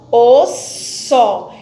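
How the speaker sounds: os and só said slowly and pulled apart; syllables split. Shown as a demonstration. Teacher slowly shows pupils where the word splits. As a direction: spell out os, só slowly for the class. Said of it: "cada um numa sílaba".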